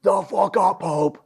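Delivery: slow, high, drawn-out scolding; drill instructor snap. Retold or imitated. Drill instructor scolding a recruit, slow and high. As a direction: bite every word at the recruit. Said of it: "And he's like".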